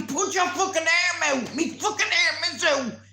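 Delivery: yelling across the field at him; a frantic shout over the noise. Retold or imitated. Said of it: "he's shouting at me".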